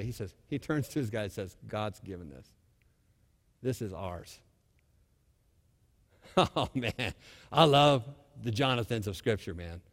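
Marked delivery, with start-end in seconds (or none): 1.7-4.4 quiet confidence. Acted. Jonathan's quiet confident words.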